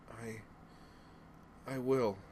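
high, weak, halting reply; faint, fading child. Acted. Daughter slipping into hibernation, faint and fading. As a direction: struggle to get it out, starting very faint. Girl losing consciousness answers father.

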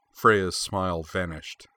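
ordinary delivery, nothing marked

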